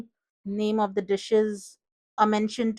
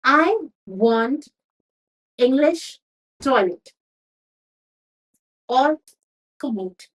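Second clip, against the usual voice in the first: furious demand; 3 semitones higher, 4 dB louder, slower than usual. Acted. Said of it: "So he was very furious".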